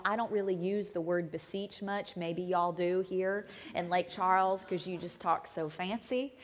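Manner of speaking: normal talking voice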